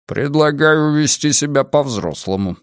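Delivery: usual speaking voice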